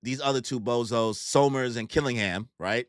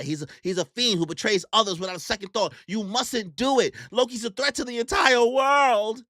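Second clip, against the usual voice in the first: building higher and louder; urgent warning; 8 semitones higher, 3 dB louder than usual. Retold or imitated. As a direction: plead ever more desperately. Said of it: "They're like".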